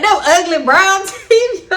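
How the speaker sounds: slow, high, laughing disgust; cracking up over the gross teeth. Real, not acted. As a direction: spit it out between laughs.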